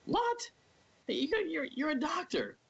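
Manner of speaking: very high-pitched retold disbelief; incredulous. Patient hearing his doctor had top secret clearance, incredulous. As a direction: re-enact a squeaky, astonished protest.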